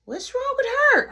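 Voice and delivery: put-on high, loud kid voice insisting; little brother voice. Little brother announcing he's ready for food, high kid voice. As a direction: whine like an eager little kid.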